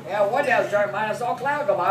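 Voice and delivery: ordinary talk, nothing marked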